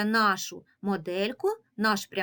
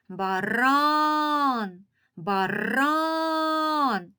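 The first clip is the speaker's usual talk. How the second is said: Баран called out with РАН stretched long and higher; higher, sing-out баРААН, twice; calling out the word; 5 semitones higher, much slower than usual. Shown as a demonstration. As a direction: call баран as if hailing it, stressing РАН. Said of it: "Залишилося погукати це слово".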